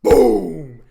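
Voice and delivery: loud booming boom; boom sound. Retold or imitated. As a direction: blast it like surround speakers. Imitating movie sound blast.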